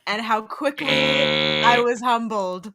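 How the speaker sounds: theatrical confession of a fallen braggart; mock-solemn confession. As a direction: deliver a hammed-up humbled speech, sinking in volume. Mock-solemn confession of humbled boasting.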